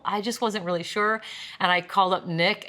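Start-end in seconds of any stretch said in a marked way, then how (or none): none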